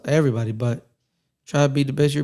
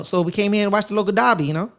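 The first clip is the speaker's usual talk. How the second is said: higher-pitched mock Australian, rising at the end; horrible Australian accent; 6 semitones higher than usual. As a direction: do a bad impression of an Australian fan. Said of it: "That's my horrible Australian accent".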